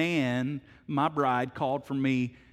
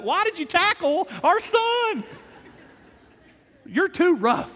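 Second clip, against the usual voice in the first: much higher, louder mock wife scolding; upset wife; 6 dB louder than usual. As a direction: play the alarmed wife. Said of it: "she's like".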